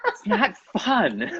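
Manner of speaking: laughing through the words, high and slow. Real, not acted. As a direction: giggle it out slowly. Laughing about blacked-out students.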